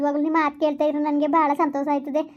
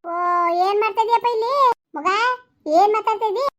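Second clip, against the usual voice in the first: father's call; 6 semitones higher than usual. Acted. Father scolds son for chatting.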